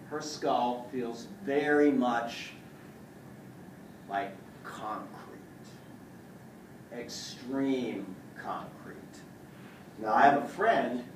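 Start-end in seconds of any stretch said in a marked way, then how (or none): 0.1-9.0 slow strained. Real, not acted. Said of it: "pressing hard on skull".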